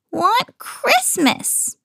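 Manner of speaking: mope it out sadly; miserable trolls. A narrator's reading. Scolded trolls sulking, slow high moan. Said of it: "sounding miserable".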